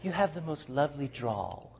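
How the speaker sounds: put-on soft British lady's accent. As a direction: imitate a British lady, hushed and admiring. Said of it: "here's what she said".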